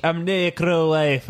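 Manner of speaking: a playful stab at a Scottish brogue; Scottish-ish accent. Retold or imitated. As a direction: lean into a rough Scottish accent. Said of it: "I was trying to do an accent".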